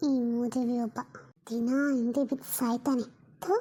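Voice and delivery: low, soft grumble under the breath; muttered curse. Acted. Annoyed woman mutters a curse at the pest, low and soft. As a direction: mutter it so he can't hear.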